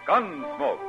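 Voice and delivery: ordinary talk, nothing marked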